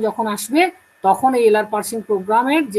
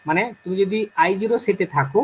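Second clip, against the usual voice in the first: ordinary talk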